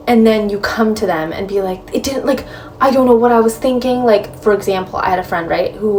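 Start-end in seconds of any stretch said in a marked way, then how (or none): 1.9-4.0 higher-pitched, mildly flustered imitation; flustered mock regret. Someone who ignored advice comes back to friends, mock regretful.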